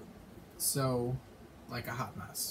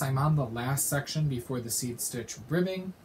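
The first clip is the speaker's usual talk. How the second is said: ordinary talk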